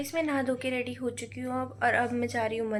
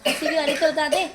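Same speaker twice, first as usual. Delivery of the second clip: laughing question; 4 semitones higher, 5 dB louder, faster than usual. Real, not acted. Teasing a giggling student.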